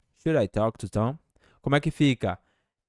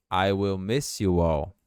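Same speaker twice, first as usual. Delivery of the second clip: ordinary delivery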